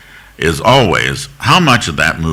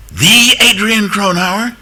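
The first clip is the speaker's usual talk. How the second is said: much higher, drawn-out incredulous question; astonished recognition; slower than usual. Retold or imitated. Guard recognizing a famous visitor's name, astonished. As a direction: stretch it out in high-pitched awe.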